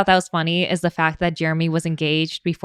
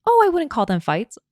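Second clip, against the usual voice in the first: high, breezy denial; airy dismissive voice; 8 semitones higher than usual. Retold or imitated. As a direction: brush it off lightly. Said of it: "And Chelsea goes".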